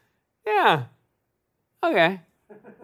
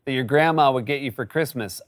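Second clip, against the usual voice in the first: ordinary talk, nothing marked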